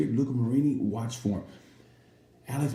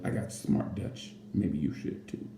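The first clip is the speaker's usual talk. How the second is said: quiet smug reply; 4 semitones lower, 5 dB quieter, slower than usual. Retold or imitated. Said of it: "And he goes".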